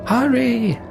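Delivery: high, urgent yell; high-pitched calling out; urgent shout. A narrator's reading. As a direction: shout to hurry the others along. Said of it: "shouts Avon".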